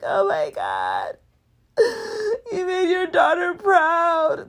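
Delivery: slow, high, choked-up tearful voice; moved to tears by mother and daughter; tearful joy. Real, not acted. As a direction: let the voice break with happy tears. Overcome with emotion.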